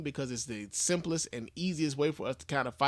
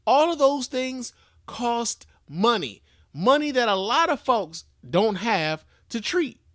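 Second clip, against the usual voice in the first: slow loud emphasis; 6 semitones higher, 4 dB louder, slower than usual. Real, not acted. Ranting about treatment costs.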